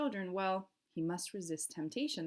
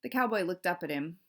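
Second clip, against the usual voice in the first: usual speaking voice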